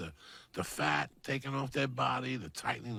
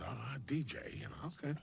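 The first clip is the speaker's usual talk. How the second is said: skeptical whisper; 5 semitones higher, 6 dB quieter than usual. Retold or imitated. Skeptical aside while quoting.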